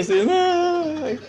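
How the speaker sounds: put-on high, thin crying little boy's "ay"; a kid whining after a fall; whimpering child. Preacher mimics a little boy crying off to the side.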